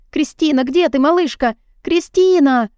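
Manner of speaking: нарастающий крик, последнее имя выше и громче; зови всё отчаяннее, срываясь на крик; отчаянный зов. A narrator's reading. Мечется по дому, зовёт девочку. (бегает по дому и зовёт малышку, всё громче)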